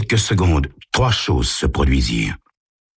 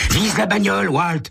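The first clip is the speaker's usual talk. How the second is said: put-on high boyish voices trading jabs; teenage joyriders. Two teens bicker in a speeding car, high and cocky. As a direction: play two reckless teens arguing over beer.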